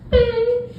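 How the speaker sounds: high squeaky mock beep; answering machine beep. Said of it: "it would be like".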